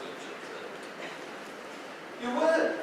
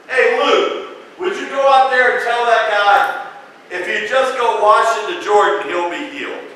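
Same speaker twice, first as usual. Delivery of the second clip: calling out to servant; 7 semitones higher, 9 dB louder than usual. Retold or imitated. Elisha calling to his servant.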